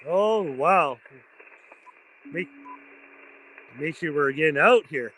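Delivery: usual speaking voice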